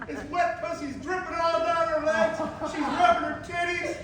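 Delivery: ordinary talk, nothing marked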